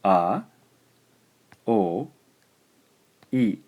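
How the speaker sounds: a, o, i sounded out one at a time; syllable by syllable. Shown as a demonstration. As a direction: break aoi into its vowels. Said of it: "First syllable".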